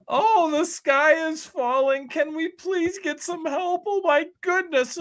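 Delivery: high, slow, whiny mock plea; mock panic. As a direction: ham up a panicking official begging for help. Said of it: "a performative act from Washington".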